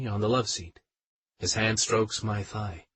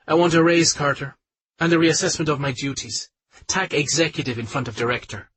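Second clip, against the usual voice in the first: higher, louder, insistent demand; demanding colleague; 5 semitones higher, 5 dB louder than usual. Acted. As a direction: stake your claim for the raise. Anne demands a raise.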